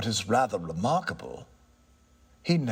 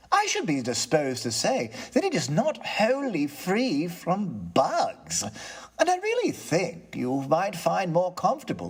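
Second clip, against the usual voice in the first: higher, composed gentleman; polite, regaining his nerve; lightly voiced tenant; 6 semitones higher than usual. Tenant answers the ghost, calm and courteous.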